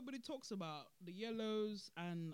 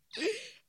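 loud high burst of laughter; 14 semitones higher, 7 dB louder than usual. Real, not acted. Vlogger bursting out laughing, loud and high.

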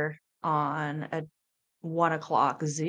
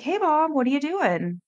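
put-on child's voice pitched much higher and louder, cheerful; mimicking a kid's bright phone greeting; imitating her young son; 10 semitones higher, 4 dB louder than usual. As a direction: lift high into an eleven-year-old boy calling his mom. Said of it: "he's like".

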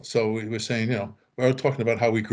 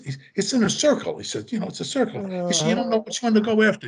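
imitated bandmate; 8 semitones higher than usual. Frank complaining, voiced higher.

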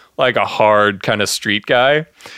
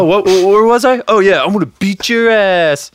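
mock tough guy; 8 semitones higher, 4 dB louder than usual. Imitating Ryan in a fight.

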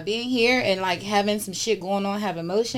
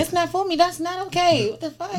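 high exclaiming; 11 semitones higher, 3 dB louder than usual. Real, not acted. Reacting to jail talk.

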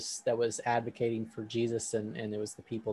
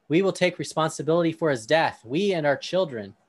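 a mild, read-aloud crowd call, a bit louder; loud crowd response; 5 semitones higher, 8 dB louder than usual. Acted. Crowd answering Pilate, reading-style raised voice. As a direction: voice the crowd calling back to Pilate, moderately raised. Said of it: "responded loudly".

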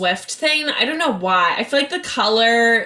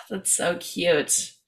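a sigh, then softer and slower on cute; touched sigh; 3 semitones lower, 7 dB quieter, slower than usual. Real, not acted. Sighing to her co-host over the merch, touched. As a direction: melt a little as you say it.

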